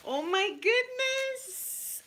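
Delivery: very high, slow awe; real delighted high squeal; drawn-out squeal. Real, not acted. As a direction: stretch it out in wonder. Overjoyed at the hat.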